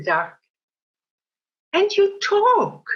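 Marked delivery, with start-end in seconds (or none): 1.7-2.8 a landlord who can't believe his ears; sudden high amazed cry; astonished exclaim. Acted. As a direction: shoot up high in surprise. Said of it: "exclaims the landlord".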